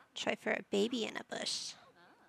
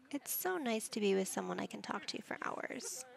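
ordinary delivery

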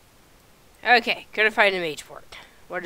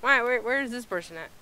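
ordinary talk

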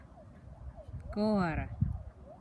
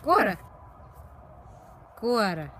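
calling a dog; 3 semitones higher than usual. Real, not acted.

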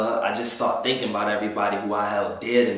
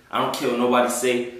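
ordinary talk

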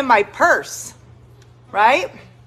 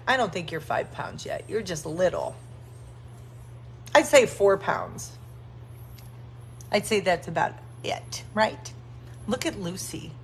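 softer, lower murmur to the puppies; 5 semitones lower, 10 dB quieter than usual. Real, not acted. Woman telling tiny puppy it isn't five pounds, soft murmur. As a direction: hush down and coo to them.

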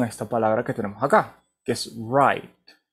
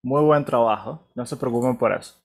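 ordinary delivery

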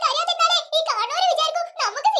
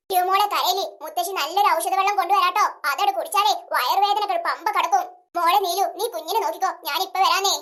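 much lower-pitched grandmother voice; comforting grandmother; 10 semitones lower than usual. Acted. Grandmother promising the sick girl herbal water, low and comforting. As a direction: soothe the child in a low, steady voice.

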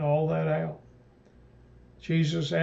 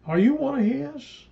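much higher, sharp probing question; suspicious question; 9 semitones higher, 4 dB louder than usual. Acted. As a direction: pin him down with the question. Soldiers question Peter by fire.

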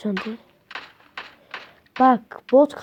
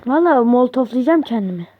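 much louder, exasperated outburst; frustrated yell; 3 semitones higher, 8 dB louder than usual. Real, not acted. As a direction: blow up at the game. Sinir oluyorum ya. (çileden çıkmış bağırış)

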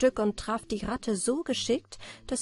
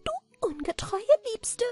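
high, gleeful sprite jeering at her; high, jeering goblin voice; mocking little water sprite. A narrator's reading. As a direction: gloat like a spiteful little goblin. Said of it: "lachte sie aus und sprach".

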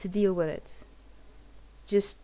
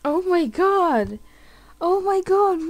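shocked gasp; 8 semitones higher, 5 dB louder than usual. Real, not acted. Shocked by the game's twist.

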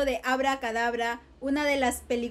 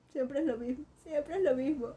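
quiet; 8 dB quieter than usual. Real, not acted. Queja baja resignada.

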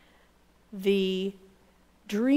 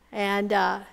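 plain reading voice

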